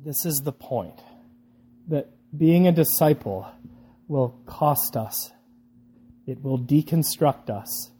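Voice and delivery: soft, hushed, earnest delivery; solemn preaching. Real, not acted. Preacher telling listeners what discipleship will cost, hushed and solemn. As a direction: bring it down to a quiet, weighty close.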